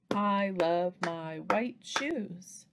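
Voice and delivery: slow, each word set apart; word-by-word tapping. Shown as a demonstration. Teacher models tapping out a sentence, word by word. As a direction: say it slowly, one tap per word. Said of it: "tap out the words in a sentence".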